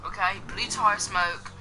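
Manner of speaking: playful put-on English accent. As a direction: chat along in a jokey English accent. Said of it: "I'm just gonna talk in this English voice".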